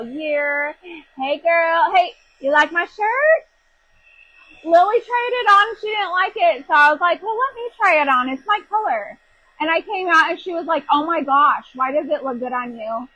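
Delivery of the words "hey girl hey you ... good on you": high, loud, bubbly chatter; excited chatter. Real, not acted. Host telling viewers about the shirt, high and bubbly. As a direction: gush about the new shirt.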